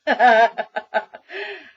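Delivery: loud laugh bursting out; real burst of laughter. Real, not acted. Reviewer cracking up at the book's punchline, real loud laugh. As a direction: crack up loudly at your own joke.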